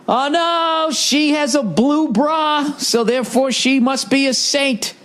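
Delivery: high, slow sarcastic mock; high drawn-out mock of a fawning pundit. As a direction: sneer it in a high mocking tone. Mocking the news coverage.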